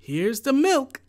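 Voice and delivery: high loud shout. A narrator's reading. Jack to his mother with the milk, a high loud shout. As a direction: call it out proudly. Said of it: "shouted Jack".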